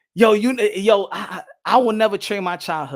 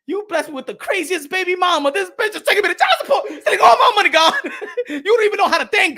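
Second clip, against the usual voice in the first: complaint to God climbing louder and higher; mock loud complaint; 8 semitones higher, 6 dB louder, faster than usual. Father ranting to God about child support, loud and high. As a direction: rant at God about the money.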